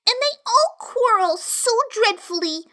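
put-on high, aggrieved little girl; complaining. A narrator's reading. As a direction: grumble like a sulky child. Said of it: "in rather a complaining tone".

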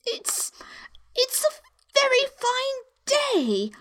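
very high, hesitant small voice; timid, thin falsetto. A narrator's reading. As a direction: start haltingly, nervously making conversation. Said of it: "said a timid voice".

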